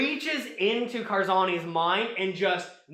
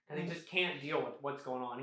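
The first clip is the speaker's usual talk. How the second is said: ordinary talk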